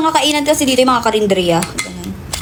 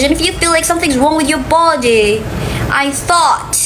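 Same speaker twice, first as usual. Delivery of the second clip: higher-pitched playful fake English accent; mock-foreign accent, pitched up; put-on accent; 4 semitones higher than usual. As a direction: protest in a high, put-on accent. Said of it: "in this accent".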